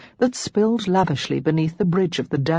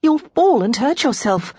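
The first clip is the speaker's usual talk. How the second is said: worried warning; 7 semitones higher, 4 dB louder than usual. A narrator's reading. Lara warns the running maid.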